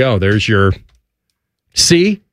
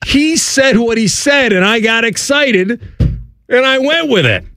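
defensive protest; 8 semitones higher than usual. Real, not acted. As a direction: plead your case against the teasing. Defends himself against teasing about accent.

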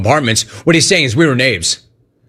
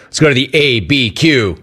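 ordinary talk

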